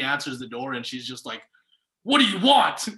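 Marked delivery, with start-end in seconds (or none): 2.0-2.9 sharp, loud and high, caught off guard; annoyed shout. Retold or imitated. Lady at the door snapping at a young salesman, mock annoyed shout. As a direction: bark it like she's bothered.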